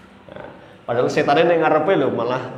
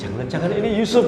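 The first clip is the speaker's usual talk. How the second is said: ordinary delivery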